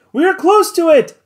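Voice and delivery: high, loud cry of alarm; cried out. A narrator's reading. Servant warning the crew, a high loud cry. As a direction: Joe cries out as the rocks near. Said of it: "cried Joe".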